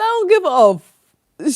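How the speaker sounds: high, loud, slow mock indifference; mock Queen of Swords. Streamer voices the card's face, exaggerated not caring. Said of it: "She's like".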